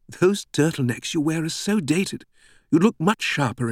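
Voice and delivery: high, playful British voice; teasing British woman. A narrator's reading. British greeter laughing at the professor's turtlenecks, playfully teasing. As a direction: chide the outfit with amused cheek.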